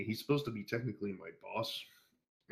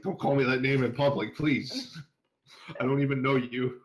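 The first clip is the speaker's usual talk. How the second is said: louder, higher mock pleading; retold protest; 5 semitones higher, 5 dB louder than usual. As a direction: plead in embarrassed mock desperation. Retold plea to embarrassing boss.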